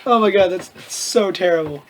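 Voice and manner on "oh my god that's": ordinary talk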